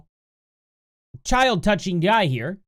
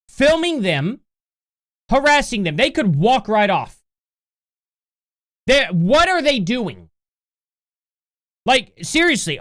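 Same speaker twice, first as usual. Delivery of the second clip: louder, slower indignant questions; outraged disbelief; 4 semitones higher, 6 dB louder than usual. Real, not acted. Reacting to men filming the girls, loud outraged disbelief. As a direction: demand answers, fed up and outraged.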